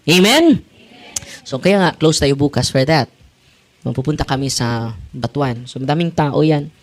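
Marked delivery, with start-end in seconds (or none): 0.1-0.6 call for amen. Real, not acted. Calling congregation to respond.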